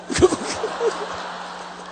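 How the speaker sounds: high, airy laugh; laughter. Real, not acted. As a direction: laugh at your own story. Laughs after his own story.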